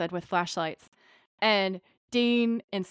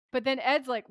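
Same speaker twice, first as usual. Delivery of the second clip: ordinary talk, nothing marked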